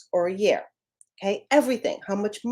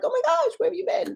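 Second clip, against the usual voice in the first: much higher squeal of happy surprise; gushing, high reunion greeting; delighted surprise; faster than usual. Retold or imitated. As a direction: greet a long-lost friend with delight. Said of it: "it's like".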